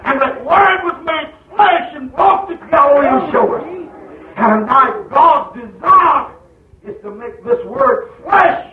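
slow, shouted, high preaching climax; preaching at full force. Acted. Preacher to church, thundering the Word. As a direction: hammer each word out to the congregation.

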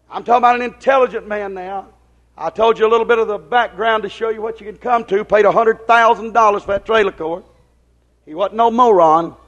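ordinary delivery

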